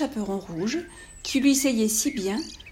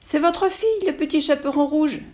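put-on wolf faking a little girl, much higher; disguised wolf voice; 6 semitones higher, 3 dB louder than usual. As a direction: squeak up like a sweet child. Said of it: "en contrefaisant sa voix".

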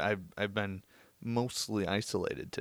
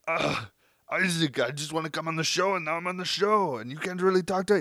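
put-on higher, louder Austrian action-hero accent; Arnold impression; 8 semitones higher, 4 dB louder than usual. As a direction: brag about the gym like Schwarzenegger. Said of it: "my Arnold impression".

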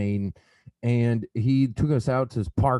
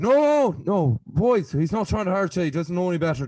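raised, urgent voice of an Irish friend; Shane pleading; 8 semitones higher, 4 dB louder than usual. Retold or imitated. Friend Shane holding back his mates, urgent and loud. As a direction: call off the boys, high and loud. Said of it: "And Shane's like".

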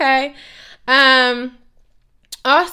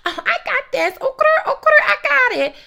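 much higher, louder, hammed-up girly confidence; 14 semitones higher, 6 dB louder than usual. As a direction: parrot her breezy overconfidence in a squeaky voice. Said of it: "And you was like".

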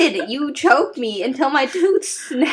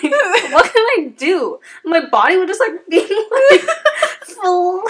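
words stretched out by laughter; laughing; 8 semitones higher, 3 dB louder than usual. Real, not acted. Retelling choking, laughing hard.

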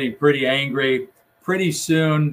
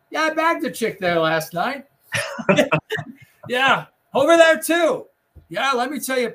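boastful womanizer; 9 semitones higher, 3 dB louder than usual. Retold or imitated. Boastful colleague quoted with laughter.

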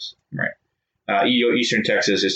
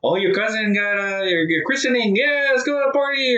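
much higher, hammed-up excited relative; mock party relatives; 12 semitones higher than usual. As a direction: cheer like a party-crazy aunt. Mocking relatives' party excuses.

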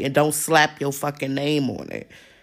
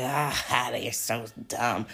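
lower, softer, slow sneer broken by a laugh; evil laughing voice; 5 semitones lower, 6 dB quieter, slower than usual. Retold or imitated. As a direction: play the villain gloating at the fools. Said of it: "like, an evil person laughing".

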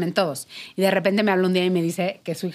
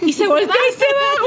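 much higher, louder, disbelieving punchline; incredulous squeal; 5 dB louder than usual. Real, not acted. Telling friends how her husband walked out, high incredulous squeal.

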